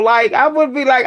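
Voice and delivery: normal talking voice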